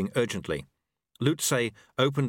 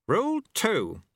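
higher, gently mocking old master; a wry old sweeper teaching his apprentice; old monk Lu Tse; 11 semitones higher than usual. A narrator's reading. Old master speaks to the dojo monks, wry.

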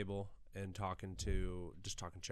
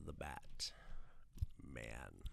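much lower, breathy Joker whisper; creepy whisper; 12 semitones lower, 12 dB quieter, much slower than usual. Retold or imitated. Joker naming the Batman to the mob, whispered low. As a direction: hiss it out like a secret threat. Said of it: "looks at him and goes".

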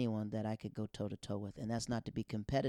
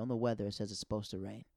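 ordinary talk

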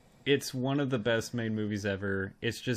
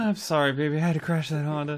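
movie quote voice; 6 semitones higher, 6 dB louder, much faster than usual. Retold or imitated. Quoting movie line in character.

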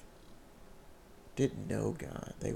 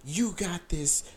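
raised, urgent encouragement, slowing on the first line; retold encouraging yell; 5 semitones higher than usual. Retold to the girl crying at the altar, a raised encouraging yell. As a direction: urge her on not to give up. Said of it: "literally yelling at her like".

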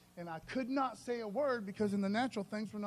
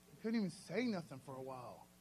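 ordinary delivery

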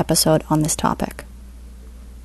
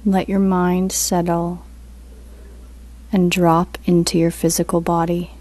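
guided meditation; 4 dB quieter than usual. Acted. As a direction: ease them into stillness, unhurried.

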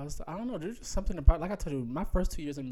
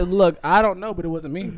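raised, insistent denial; higher, louder indignant defence; loud protest; 6 semitones higher, 12 dB louder than usual. Retold or imitated. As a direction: plead your innocence loudly. Retelling protest to mom.

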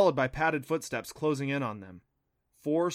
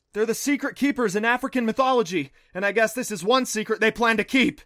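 loud, raised-pitch shouted explanation; urgent explanation at a yell; calling back; 9 semitones higher, 9 dB louder than usual. A narrator's reading. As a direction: shout it over your shoulder while fleeing. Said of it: "Adam called back".

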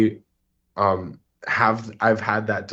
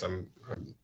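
hoarse, trailing into near silence; rough voice fading out; raspy voice; 3 semitones lower, 13 dB quieter than usual. Real, not acted. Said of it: "excuse my voice".

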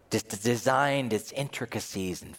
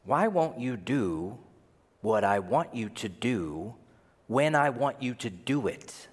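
deliberate demand. Acted. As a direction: spell out the demand piece by piece. First, spelled-out take of the demand.

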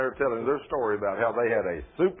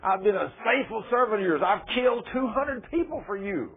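high, insistent imitated plea; crying out; 11 semitones higher, 4 dB louder than usual. Wounded servant pleading with Allah for repayment, crying out. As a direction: retell a desperate cry to a god, pitched high. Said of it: "I'm crying out to Allah".

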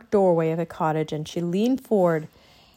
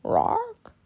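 high squawked croak; small bird voice, pitched very high; raven croak; 3 dB quieter than usual. A narrator's reading. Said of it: "the raven croaked".

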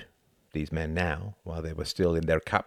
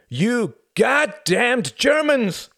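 slow, drawn-out yell, much higher and louder; furious shout; 8 dB louder, much slower than usual. A narrator's reading. Tom shouting at the German drinkers, furious. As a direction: hurl it at the whole table. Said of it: "he shouted".

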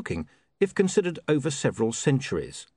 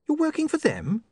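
put-on high, sharp young woman's voice; an offended, prim young lady; indignant young woman. Sacharissa accuses.

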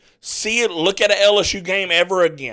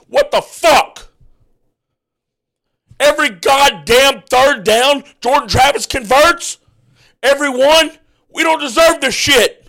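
real furious yelling; 7 semitones higher, 5 dB louder than usual. Real, not acted. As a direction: yell at the TV in real disbelief. Ranting fan, team losing badly.

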